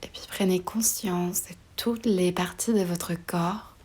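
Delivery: ordinary talk, nothing marked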